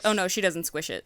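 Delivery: ordinary talk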